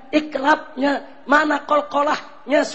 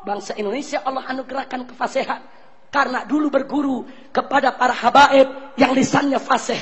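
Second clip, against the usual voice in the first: normal talking voice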